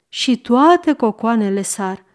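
ordinary delivery

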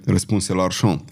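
ordinary delivery, nothing marked

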